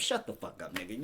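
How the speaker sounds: usual speaking voice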